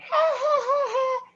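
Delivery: very high carnival-style fake laugh; high, put-on carnival cackle; Joker laugh. Shown as a demonstration. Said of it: "it's almost like that carnival sounding".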